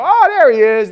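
high, loud crowing, imitating friends; mock gleeful recognition. Friends see you lose your temper, mock crowing. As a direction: pounce on it like a jeering friend.

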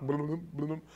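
bubbling mouth noise; lip-flapping bubble sound effect; imitated boiling. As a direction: burble like ashes boiling up. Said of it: "they start boiling up".

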